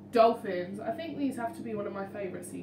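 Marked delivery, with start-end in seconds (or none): none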